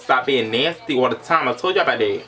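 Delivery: ordinary talk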